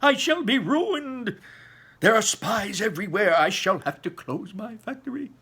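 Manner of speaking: high, loud, despairing cry of ruin; panicked shout. Acted. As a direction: wail it like a man watching his empire collapse. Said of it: "tore his beard and shouted".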